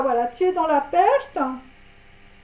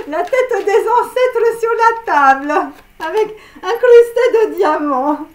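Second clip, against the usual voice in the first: mock solemn laughing; 3 semitones higher, 7 dB louder than usual. Reader hamming up the skull card, laughing. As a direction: ham up the ancestors' skull while laughing. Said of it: "Je suis désolée, je rigole".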